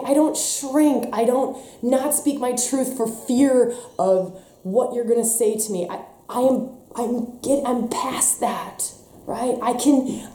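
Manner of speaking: ordinary delivery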